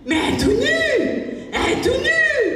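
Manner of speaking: mother shouting at daughter in shock; shocked outcry. Retold or imitated. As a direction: cry out in alarm. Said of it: "J'ai dit".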